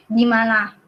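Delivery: ordinary talk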